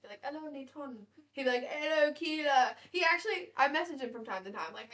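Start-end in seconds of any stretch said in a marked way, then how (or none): 0.2-1.0 French-style greeting, h dropped from Hello; French accent. Retold or imitated. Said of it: "I learned that in French class". 1.7-2.8 slow French-style 'ello, drawn out; French accent. Retold or imitated. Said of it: "Keela in French is my name".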